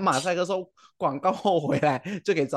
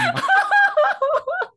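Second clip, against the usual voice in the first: loud shrieking laugh; 7 dB louder than usual. Real, not acted. Cringing at riding the MRT.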